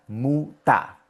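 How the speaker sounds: "muta" split as "mu ... ta"; syllable demo. Shown as a demonstration. Teacher showing why it sounds that way, split "mu, ta". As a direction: separate mu from the final open ta. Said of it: "por eso es que suena".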